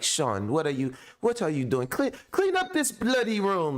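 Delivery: mock British English mother scolding, pitched high; British-accented mom. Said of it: "she speaks British English".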